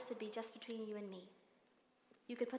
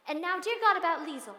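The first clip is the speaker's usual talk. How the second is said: sudden loud, higher burst in prayer; exasperated outburst; 5 semitones higher, 12 dB louder than usual. Acted. Governess praying, vexed by the eldest girl, loud outburst. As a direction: blurt out the exasperation about Liesel.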